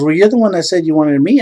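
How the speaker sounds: high, loud mock-angry comeback; heated date impression; mad retort. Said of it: "she gets all mad and pissed off".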